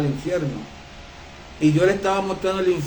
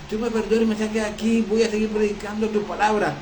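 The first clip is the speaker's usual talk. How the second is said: pleading woman; 4 semitones higher, 4 dB quieter than usual. Retold or imitated. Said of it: "una mujer que decía".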